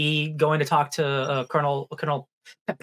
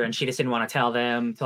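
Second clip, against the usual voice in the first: ordinary delivery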